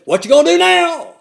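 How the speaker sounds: put-on loud, high gravelly old preacher bark; loud raspy old preacher voice; impression of Brother Miller. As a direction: boom it out like an old-time Georgia preacher. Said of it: "That kinda sounds like him".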